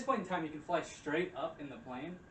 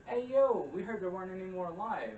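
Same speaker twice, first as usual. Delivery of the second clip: higher, louder playful hey yo; mock chipper greeting; 6 semitones higher, 7 dB louder than usual. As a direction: call out brightly as the heroes. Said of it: "It's like".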